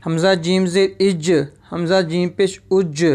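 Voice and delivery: "इज", "उज" with a bounced, echoing j; qalqala bounce. Shown as a demonstration. Teacher demonstrates qalqala on jeem, bouncing "इज" and "उज". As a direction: release the final j of "इज" and "उज" with a bounce. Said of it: "इसको हम हलाका पढ़ेंगे".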